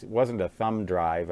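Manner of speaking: ordinary talk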